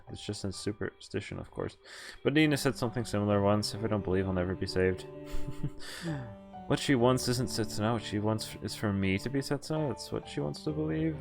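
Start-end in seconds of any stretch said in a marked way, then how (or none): none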